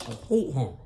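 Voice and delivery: ordinary delivery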